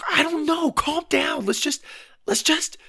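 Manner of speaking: high-pitched, faltering reassurance; harried girl's high voice; flustered sister. A narrator's reading. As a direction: try to calm a panicking brother. Flustered sister calms brother.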